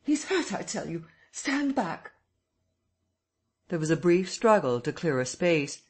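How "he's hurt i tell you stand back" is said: high, pleading shouts; urgent shouting. A narrator's reading. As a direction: hold the crowd back. Kemp pushes crowd back.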